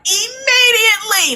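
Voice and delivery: mock-indignant cry, stretched high; imitated emphatic outburst. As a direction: belt the word out in imitation. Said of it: "That's how she said it".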